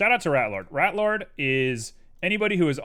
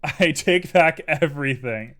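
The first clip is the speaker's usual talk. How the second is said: slow, louder, laughing; 3 semitones higher, 7 dB louder than usual. Real, not acted. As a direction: laugh while taking it back.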